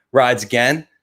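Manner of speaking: ordinary talk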